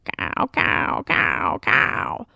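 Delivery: low, breathy caws; urgent crow warning; panicked caw. Acted. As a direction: caw with all his might. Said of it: "a panic rising in his throat".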